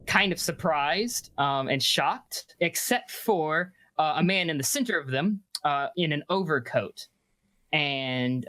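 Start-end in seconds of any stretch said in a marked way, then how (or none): none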